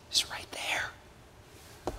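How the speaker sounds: breathy hushed whisper, slow. Acted. Preacher to congregation, a slow hushed whisper. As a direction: draw them in with a slow whisper.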